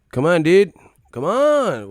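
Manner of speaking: loud exasperated high call; shout. Real, not acted. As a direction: yell it out in disbelief. Shouting at chat.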